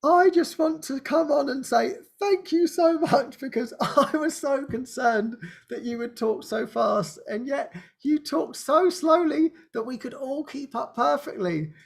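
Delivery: grateful translator. Retold or imitated. Translator Anya thanking the speaker, high and gushing. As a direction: gush your thanks, nearly tearful. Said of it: "And she just said".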